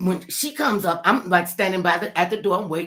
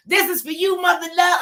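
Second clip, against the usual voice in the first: stage call-out; 12 semitones higher, 7 dB louder than usual. Retold or imitated. Gladys calling out from stage.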